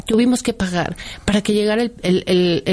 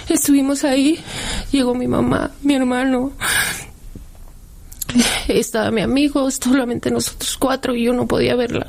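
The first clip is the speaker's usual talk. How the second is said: voz quebrada; 5 semitones higher than usual. Real, not acted. Sigue contando entre lágrimas.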